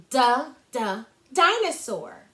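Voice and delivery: "Duh, duh" crisp, then "dinosaur"; two crisp d taps, then the word; letter sound demo. Shown as a demonstration. Teacher showing how to say the sound twice, crisp. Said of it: "Like this".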